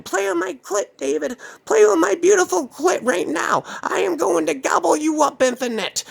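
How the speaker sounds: very high crazed pursuer; Sarah. Retold or imitated. Woman chasing David up the block, high crazed voice. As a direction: chant it in a piercingly high put-on voice. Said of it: "She was chasing me up the block saying".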